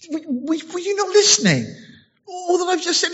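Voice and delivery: high, incredulous angel voice; high-pitched frustrated angel asking if he listened; exasperated Gabriel. Retold or imitated. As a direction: play Gabriel baffled he wasn't heard. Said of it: "Gabriel was like".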